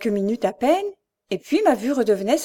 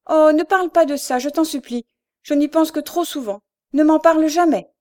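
higher, pleading through tears; tearful pleading; 6 semitones higher, 4 dB louder than usual. A narrator's reading. Louise begging her sister to drop it, tearful plea. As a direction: beg her sister not to speak of it. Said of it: "elle pleurait sur son épaule".

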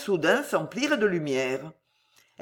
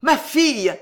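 terrible cry; 6 dB louder than usual. A narrator's reading. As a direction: cry out for a lost daughter. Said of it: "hurla-t-il dans un cri terrible".

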